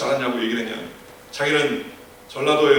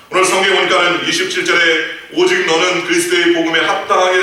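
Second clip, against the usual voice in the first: louder preaching, reading the verse out with weight; full-force preaching; 4 semitones higher, 4 dB louder than usual. Acted. Pastor reading Philippians aloud to the congregation, preaching at full force. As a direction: proclaim the scripture to the whole congregation.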